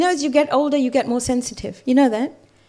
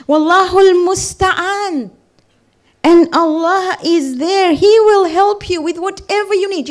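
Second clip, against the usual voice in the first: raised, louder, slow preaching; preaching at full force; 4 semitones higher, 6 dB louder than usual. Acted. Preacher proclaiming Allah's help to the congregation, slow and loud. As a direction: lift the voice and proclaim it slowly.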